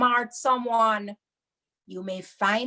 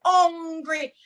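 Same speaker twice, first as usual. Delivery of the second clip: slow, higher and louder, "Me hungry." whined; dramatic hungry whine; 6 semitones higher, 4 dB louder, slower than usual. Shown as a demonstration. Auntie [name] acting out a hungry plea. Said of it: "you have to make it dramatic".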